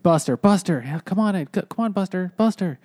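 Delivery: high, coaxing dog call. Acted. Kids call their dog to save them, coaxing.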